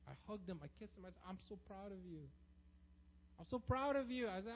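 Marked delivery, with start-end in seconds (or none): 1.3-2.2 quiet and tender; tender whisper-soft praise. Retold or imitated. Father comforting crying son. 3.4-4.3 louder and higher, bursting with pride; emphatic praise. Retold or imitated. Father comforting crying son.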